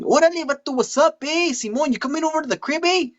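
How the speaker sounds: high-pitched Chicano accent; cartoonish Chicano homeboy, way up high; Chico. Shown as a demonstration. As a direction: play an excitable vato in a high Chicano voice. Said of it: "a character who is a Chicano character".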